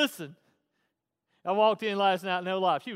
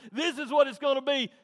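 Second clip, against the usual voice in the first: stern father; 7 semitones higher, 7 dB louder than usual. Retold or imitated. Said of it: "it's not just saying".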